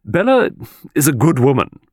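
put-on high, emphatic old lady pronouncing; grave approval. A narrator's reading. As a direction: state it firmly as a weighty verdict. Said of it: "said with grave approval".

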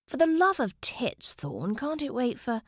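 high exasperated outburst, broken off. A narrator's reading. Lord opens door expecting Thorn, exasperated. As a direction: snap at an unwelcome interruption.

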